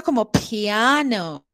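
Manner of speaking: piano said slowly, I blended into the A; slow English piano; joined I, slow. Shown as a demonstration. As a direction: draw out piano gently, vowels joined. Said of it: "Como acoplamos esa I".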